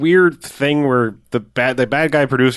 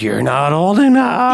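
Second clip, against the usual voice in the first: slow mother voice, pitched up; a slow, higher mock mother; 6 semitones higher, slower than usual.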